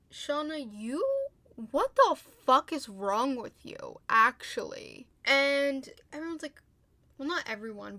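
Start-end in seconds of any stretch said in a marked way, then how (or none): 0.1-5.1 loud, slow, incredulous scolding; emphatic, fed-up telling-off; exasperated rant. Real, not acted. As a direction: draw out the outrage word by word. Ranting at the character Shauna.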